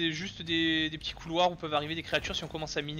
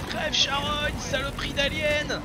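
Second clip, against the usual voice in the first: high, sustained yell of abuse; hammed-up furious combat shout; battle cry; 10 semitones higher, slower than usual. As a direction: hurl drawn-out curses at the monster. Cursing an alien while shooting it.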